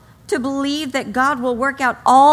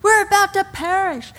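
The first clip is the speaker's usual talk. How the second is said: mock panicked cry; 8 semitones higher than usual. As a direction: wail it like you're sinking. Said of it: "they're like".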